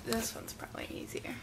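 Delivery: soft whispered aside; whispering. Real, not acted. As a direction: whisper it to yourself. Quiet aside over lip gloss.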